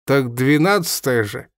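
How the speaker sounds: usual speaking voice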